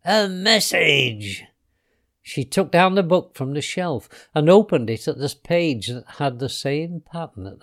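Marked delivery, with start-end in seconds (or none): 0.0-1.5 slow, higher, stretched-out delight; drawn-out triumphant glee; gleeful exclamation. A narrator's reading. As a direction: crow over the discovery. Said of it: "she shouted in glee".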